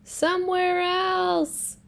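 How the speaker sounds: high, slow, drawn-out somewhere else; exasperated plea. Acted. Elephant pleads with Piggy to have the birds gone, slow and high.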